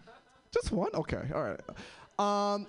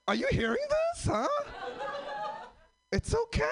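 squeaky high put-on voice; a hammed-up high squeak; mock high voice. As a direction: pitch way up to mock himself. Mocking his own weird voice.

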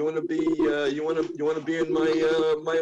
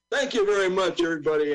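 usual speaking voice

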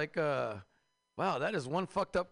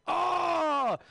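orgasm yell; 13 semitones higher, 10 dB louder than usual. Acted. Climax in space porno.

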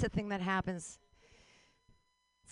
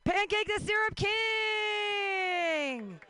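slow, stretched, much higher and louder announcer yell; hyped intro shout; 10 semitones higher, 4 dB louder, much slower than usual. Real, not acted. Host hyping up the crowd for a name, in a big stretched shout. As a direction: belt out the name like a ring announcer.

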